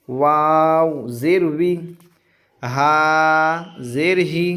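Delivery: each syllable slow and clean, with long pauses; slow clear recitation. Shown as a demonstration. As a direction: recite the letters slowly, one by one. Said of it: "ऐसे ही पढ़ेंगे, बिल्कुल साफ साफ".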